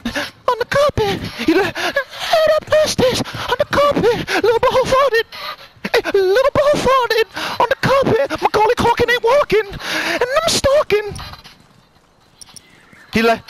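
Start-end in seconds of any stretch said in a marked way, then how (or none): 0.1-11.1 squeaky high rap voice; high-pitched voice. Pretend. Gamer rapping in a silly high-pitched voice. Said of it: "fucking high-pitched voice".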